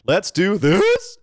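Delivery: high, drawn-out squeal; high-pitched voice. Acted. Co-host joking about a wrestling manager, high-pitched voice. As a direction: push it up high and stretch it. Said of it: "that's such a high-pitched voice".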